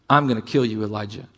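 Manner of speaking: low, quiet threat; Jezebel. Acted. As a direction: menace softly, dropping away on the name. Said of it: "Jezebel makes a threat".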